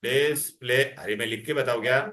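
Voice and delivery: ordinary talk, nothing marked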